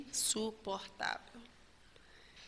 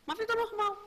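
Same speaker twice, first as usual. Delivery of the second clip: mock naive voice; 11 semitones higher, much faster than usual. Mocking repeat of normal life.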